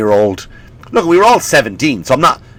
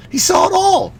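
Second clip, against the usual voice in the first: sudden high squeak. Shown as a demonstration. Comic repeating the vampire's age point, high squeak. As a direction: repeat it much higher, squeaky and amazed. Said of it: "repeated line".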